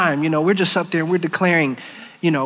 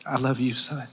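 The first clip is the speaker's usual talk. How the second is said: soft, low and tender, much quieter; very gently; 5 semitones lower, 9 dB quieter than usual. Retold or imitated. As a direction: breathe it out gently, like a father holding his son. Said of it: "he very gently said".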